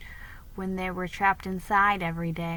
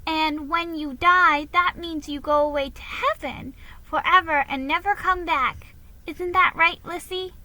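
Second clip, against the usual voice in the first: put-on high and earnest like a small girl; a child seeking her sister's confirmation; little girl voice; 8 semitones higher, 4 dB louder than usual. A narrator's reading. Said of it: "said Nan".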